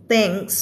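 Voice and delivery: "Thanks" with an audible S at the end; final S in THANKS. Shown as a demonstration. Teacher showing the S must sound, "Thanks" with final S. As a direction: let the S of "Thanks" hiss out. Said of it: "เวลาออกเสียงก็ต้องมี S".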